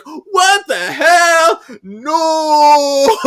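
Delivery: high, drawn-out shout of protest; horrified yell. Retold or imitated. Austin protests as his friend aims the gun, horrified yell. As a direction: wail it out slowly, very loud and high. Austin reacts to threat of shooting.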